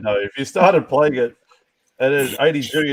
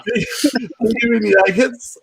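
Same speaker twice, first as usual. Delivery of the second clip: laughing; 8 semitones higher, slower than usual. Real, not acted. Laughing while giving joke advice.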